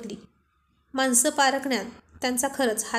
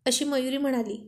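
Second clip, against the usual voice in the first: ordinary delivery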